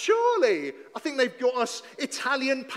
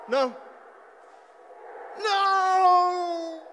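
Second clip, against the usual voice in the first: dismayed cry; 5 semitones higher, 3 dB louder than usual. Acted. Goal conceded.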